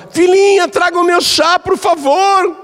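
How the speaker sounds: put-on very high, sugary old woman; doting mother-in-law. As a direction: ask sweetly like a fond granny. Mother-in-law sweetly requests tea. (sogra docinha, voz muito aguda)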